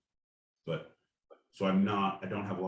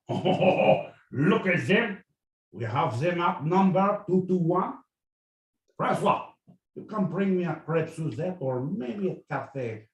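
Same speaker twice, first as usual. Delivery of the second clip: high, loud hammed-up French general; 4 semitones higher, 6 dB louder than usual. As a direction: gloat like a pompous French officer. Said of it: "if I'm doing full on".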